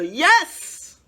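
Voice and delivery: very high, loud yelled yes. A narrator's reading. King approving a dish, yelling. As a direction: yell your approval like a king. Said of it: "he would yell".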